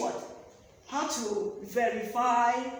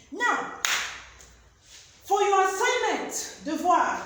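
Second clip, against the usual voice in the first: emphatic announcement; 8 semitones higher, 6 dB louder, slower than usual. Real, not acted. Teacher announces the homework.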